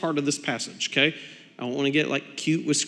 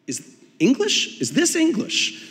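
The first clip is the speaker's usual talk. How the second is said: much higher incredulous question; puzzled squeak at the instructions; high mock bafflement; baffled; 9 semitones higher than usual.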